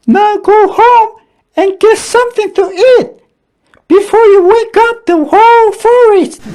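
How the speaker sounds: very high, loud, quick squeaky scolding; angry squirrel scolding. A narrator's reading. Angry squirrel sending Dragon home, scolding squeakily. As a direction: tell him off. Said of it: "cried the angry squirrel".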